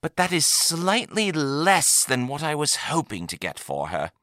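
high, letting down gently, falling at the end; regretful trader. A narrator's reading. Trader turning down the buyer's offer, politely regretful. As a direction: break the bad news delicately.

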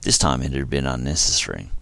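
ordinary delivery

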